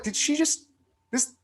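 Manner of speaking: can't believe what just happened; baffled disbelief. Retold or imitated.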